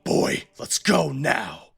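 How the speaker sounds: slow, heavy command from a cruel father; slow menacing father. Acted. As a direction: drag it out like a threat. Father orders boy in game scene.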